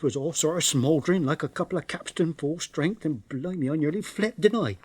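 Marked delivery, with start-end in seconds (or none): none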